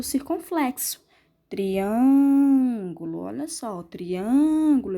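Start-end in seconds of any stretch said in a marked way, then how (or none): 1.5-3.2 "Triângulo" with a very long, swelling ÂN; stressed closed vowel. Shown as a demonstration. Teacher shows the closed sound, ÂN held long in "Triângulo". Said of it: "não abro muito a minha boca". 3.8-5.0 "triângulo" with a drawn-out ÂN; stressed closed vowel. Shown as a demonstration. Teacher repeats the example, stretched closed ÂN in "triângulo". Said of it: "não abro muito a minha boca".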